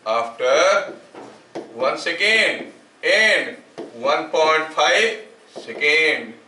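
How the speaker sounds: drawn-out phrases with long gaps for writing; slow dictation. Real, not acted. Teacher dictating a horn echo problem, slow dictation. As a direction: read it out very slowly, stretching each word.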